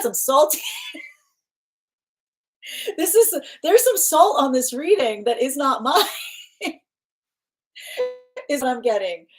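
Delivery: high-pitched amused speech; words lifted high by real laughter; laughing. Real, not acted. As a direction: break into laughter mid-sentence. Laughing at the saltiness picked up.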